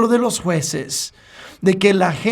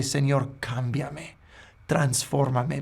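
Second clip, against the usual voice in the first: much softer, slower surrender; humble prayer; 4 semitones lower, 8 dB quieter, slower than usual. Retold or imitated. Preacher contrasts the repentant prayer, hushed and slow. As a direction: pray it quietly, asking to be changed. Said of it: "en vez de".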